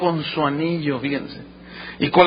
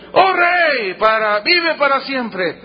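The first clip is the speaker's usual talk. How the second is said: calling out; 5 semitones higher, 3 dB louder, slower than usual. Acted. Daniel answering the king from the lions' pit, calling out. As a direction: hail him from afar, easing off.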